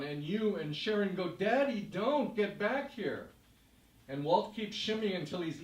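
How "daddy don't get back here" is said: pleading call. Retold or imitated. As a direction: call him back from the balcony. Daughters calling to their dad.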